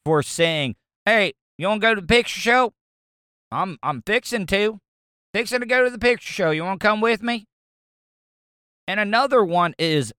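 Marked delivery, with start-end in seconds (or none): 1.0-7.5 a younger self, fast and higher, lightly Southern; mock the old Southern way of talking; playful Southern teen voice; Southern accent. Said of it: "I used to get clowned".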